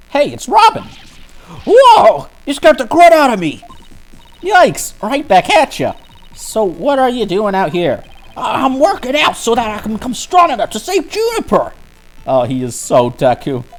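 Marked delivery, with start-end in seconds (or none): 0.1-11.7 Robin. Acted. Voices Robin, shouting student.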